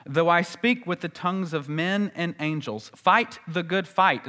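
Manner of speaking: measured, reverent King James recital; scripture reading. Acted. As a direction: intone the old Bible phrases with reverence. Quotes scripture phrases.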